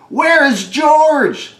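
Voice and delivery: high, slow hollered call. Acted. Sailors call out for missing George, loud and high. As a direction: shout it across the deck, drawn out. Sailors calling for lost monkey.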